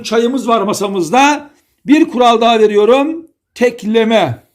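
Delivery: ordinary talk, nothing marked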